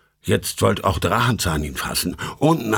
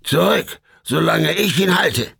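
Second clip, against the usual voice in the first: calling out; 6 semitones higher than usual. Acted. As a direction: shout the others back.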